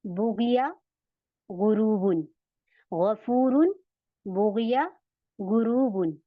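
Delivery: बुगिया and गुरूबुन with thick throaty gh; heavy ghain drill. Shown as a demonstration. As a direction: recite गफूरुन word by word, gh from the back. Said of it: "मोटा बनाना है इसको हर हाल में".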